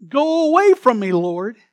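loud, pleading cry to the Lord; anguished plea. Acted. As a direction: Peter crying out at Jesus' knees. Peter's plea acted out.

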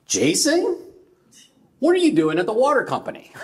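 high, startled, laughing uncle; high-pitched surprise breaking into a laugh; an uncle shocked to hear his nephew, laughing; surprised uncle. Retold or imitated. Uncle recognizes nephew on phone.

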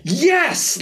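high, thrilled shout of yes; excited teacher cheer. Retold or imitated. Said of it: "He was like".

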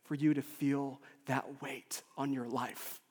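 slow, soft comfort; quiet, unhurried consolation; healing reassurance. Retold or imitated. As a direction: say it gently, taking your time. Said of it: "most healing things for me".

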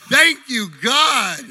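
loud laughing thanks. Real, not acted. Laughing after the gesture.

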